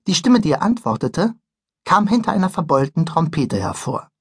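plain reading voice

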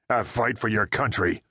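ordinary delivery, nothing marked

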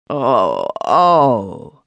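a crying outburst of grief, Oh then oh; sudden emotional cry. A narrator's reading. Smith mourning a hanged neighbour, crying out suddenly. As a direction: let it break out of him in a sob. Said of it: "cried Smith in a sudden burst of emotion".

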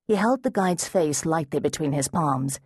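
ordinary delivery, nothing marked

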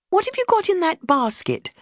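high, eager question; bursting to know what's inside; eager curiosity. Retold or imitated. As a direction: pounce on the basket with curiosity. Eager question about the basket.

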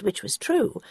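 usual speaking voice

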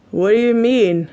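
lightly slurred tipsy question; a touch of boozy slur; drunken slur. A narrator's reading. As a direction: play it mildly drunk. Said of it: "He slurs and flops over".

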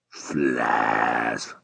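very low, drawn-out growl of contempt; deep disgust. Acted. Taxi driver scorning his fare's errand, disgusted. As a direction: growl the word out long and low.

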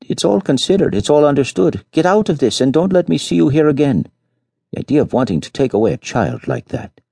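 exasperated, cutting him short. Acted. Peasant mother throwing the rich couple out, exasperated. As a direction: slam the door on the conversation. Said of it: "however, exasperated, cut him short".

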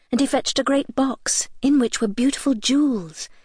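plain reading voice

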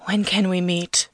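slow, subdued creepy caller; understated quiet caller voice. A narrator's reading. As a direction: ask it slowly, with hushed eagerness. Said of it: "His voice is quiet now".

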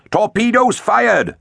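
high, drawn-out battle cry; calling out. A narrator's reading. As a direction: announce the launch in a slow, high call. Battle report called out in story.

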